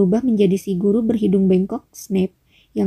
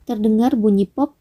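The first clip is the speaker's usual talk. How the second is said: plain reading voice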